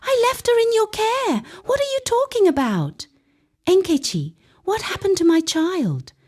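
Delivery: very high, frantic mother's cry; screaming. A narrator's reading. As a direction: scream it in terror. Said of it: "Amara's scream".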